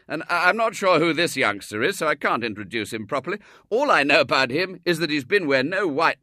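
hearty, teasing father; a genial businessman joking; jovial gentleman. A narrator's reading. Tall man talks jovially.

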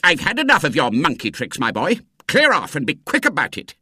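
put-on savagely ordering a boy away; savage telling-off. A narrator's reading. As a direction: snap at a pestering boy. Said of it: "turned on him savagely and said".

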